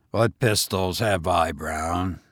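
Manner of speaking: a hint of a cool master asking; lightly voiced master. A narrator's reading. Master questions his gamekeeper.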